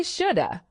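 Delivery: "shoulda" as one linked word ending in a weak uh; connected speech. Shown as a demonstration. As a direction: blend should and have into "shoulda". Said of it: "These are connected speech patterns".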